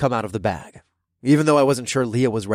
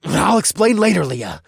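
growled; 5 semitones higher, 5 dB louder than usual. Acted. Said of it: "I growled over my shoulder".

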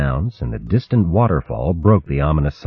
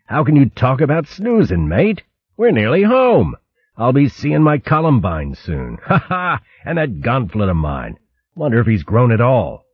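cheerful, high and eager; bubbling over about going home; excited; 6 semitones higher than usual. Acted.

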